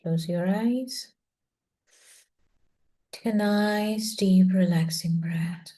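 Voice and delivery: slow meditation guide, words drawn out; guided relaxation. Acted. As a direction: ease them slowly into a relaxing breath.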